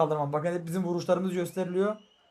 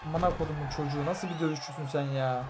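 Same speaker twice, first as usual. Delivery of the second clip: muttered curse; 3 semitones lower, 8 dB quieter than usual. Real, not acted. Cursing the opponent under breath.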